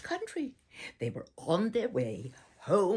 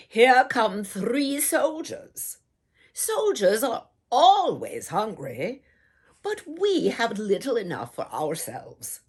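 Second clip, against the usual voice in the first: lightly voiced fearful peasants, louder; worried villagers; 4 semitones higher, 7 dB louder than usual. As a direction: villagers muttering their worry aloud.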